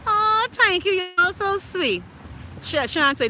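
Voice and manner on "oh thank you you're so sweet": high-pitched, drawn-out gushing thanks; touched thanks. Real, not acted. Host thanking a kind viewer, high and gushing. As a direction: gush it slowly, way up high.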